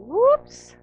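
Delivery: high, loud mock surprise; whoops. Caught out after eating all the pasta, playful squeal. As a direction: sing out a guilty little whoops.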